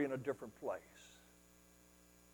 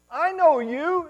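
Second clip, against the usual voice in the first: accusing; 13 dB louder, slower than usual. Acted. Another accuser.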